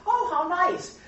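high, sugary fake delight; mock mild approval. Teacher imitates a comfortable reader of battles, mock approval. Mocks a cosy Bible reader.

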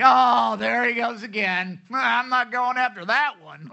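high, loud scornful mock voice; self-righteous sneer. Pastor mocking a self-righteous shepherd, loud sneering scorn. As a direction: sneer it out, looking down on the stray. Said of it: "He's not self-righteous saying".

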